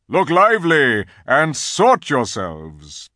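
loud, measured marching orders; ringing voice of a lion in command; commanding lion leader. A narrator's reading. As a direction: call out each group clearly to a crowd. Aslan gives marching orders.

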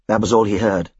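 usual speaking voice

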